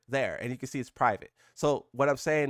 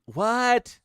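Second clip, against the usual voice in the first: high, louder startled question; baffled; 8 semitones higher, 6 dB louder than usual. Real, not acted. Streamer reading slang results, baffled. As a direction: pitch leaps up in disbelief.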